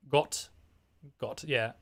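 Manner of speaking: a hint of a German accent; lightly put-on German accent. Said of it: "having a bad German accent".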